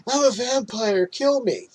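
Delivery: high, hammed-up madman's plea; mock freaking out. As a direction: imitate a crazed plea to passers-by. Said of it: "he's freaking out".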